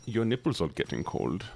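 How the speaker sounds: put-on comic line in a German accent. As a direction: deliver it in a mock German accent. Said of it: "Just in that voice".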